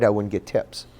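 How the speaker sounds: ordinary delivery, nothing marked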